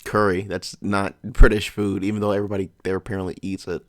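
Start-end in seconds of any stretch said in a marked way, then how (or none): 0.4-2.0 slow and louder, leaning on British; emphatic objection. Real, not acted. Reacting to curry being called British, slow emphatic denial. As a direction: drag out each word to flatly deny it. Arguing back at the video.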